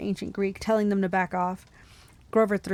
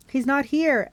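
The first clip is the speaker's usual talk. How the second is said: higher, urgent call, understated; mild yell; 6 semitones higher, 3 dB louder, slower than usual. A narrator's reading. Annabeth to her friends as Furies attack, mild yell. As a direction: call it out, not at full force. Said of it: "Annabeth yelled".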